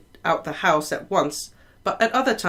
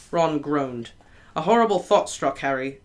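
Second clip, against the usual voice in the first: plain reading voice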